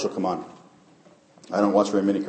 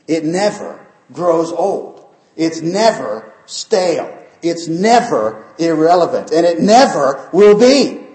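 rising, slow, high preacher's build; preaching at full force; 7 semitones higher, 6 dB louder, slower than usual. Acted. Preacher building the congregation to a peak, slow and rising. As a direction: build it higher and louder each line.

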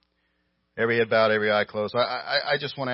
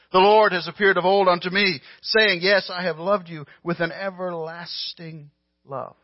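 high, loud scripture reading; fervent Bible reading; preaching at full force; 8 semitones higher, 8 dB louder than usual. Acted. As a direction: proclaim it from the pulpit. Reads scripture with rising force.

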